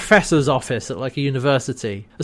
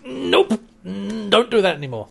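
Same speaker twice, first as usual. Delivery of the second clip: high, mock-strained self-correction; [name]; 5 semitones higher than usual. [name] fighting his saluting arm, mock strained voice. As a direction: scold your own wayward arm in a put-on voice. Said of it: "dragged his arm down".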